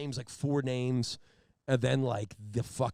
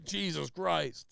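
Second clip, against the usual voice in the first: drawn-out appalled oath, pitched up; unamused exasperation; 4 semitones higher, much slower than usual. Retold or imitated. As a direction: imitate a coworker refusing to sympathize. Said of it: "He didn't even laugh".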